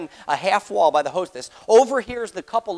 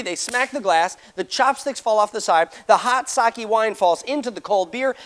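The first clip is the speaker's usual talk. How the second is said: normal talking voice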